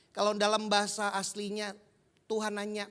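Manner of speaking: ordinary talk, nothing marked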